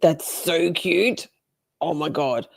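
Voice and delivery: normal talking voice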